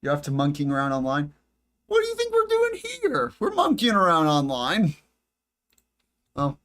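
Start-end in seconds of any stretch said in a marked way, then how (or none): 1.9-4.9 high, squeaky mocking reply. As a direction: squeak it out like a cartoon. Mock reply to imagined critic.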